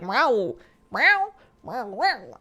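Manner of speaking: scolding meows, middle one high; angry cat meows. Retold or imitated. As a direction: meow like a cat angry you left. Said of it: "she's, like, angry that I left".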